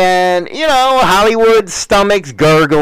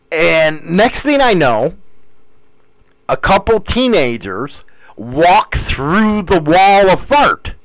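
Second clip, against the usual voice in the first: ordinary talk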